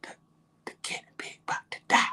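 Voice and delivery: unvoiced-sounding secretive whisper; imitating a hushed, urgent whisper; wife's whisper. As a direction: whisper a warning to your partner. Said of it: "So my wife keeps saying".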